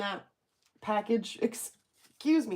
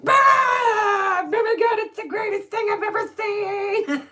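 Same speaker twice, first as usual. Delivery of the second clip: much higher and louder, gushing with joy; ecstatic squeal; 11 semitones higher, 6 dB louder than usual. Retold or imitated. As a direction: squeal like it's the best thing ever. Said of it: "my body was like".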